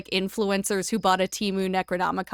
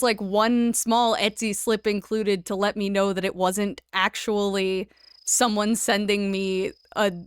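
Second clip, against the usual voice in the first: ordinary talk, nothing marked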